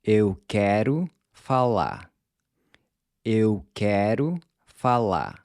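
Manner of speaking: falar with the final R almost silent; slow Portuguese falar with no final R; barely any R. Shown as a demonstration. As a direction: Eu quero falar, dropping the last R. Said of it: "I'm barely pronouncing the R in falar".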